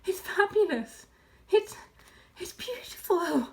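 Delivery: fighting for breath. A narrator's reading. Fox overcome by cider, halting breathless praise. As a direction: break it up as if short of breath. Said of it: "fighting for breath".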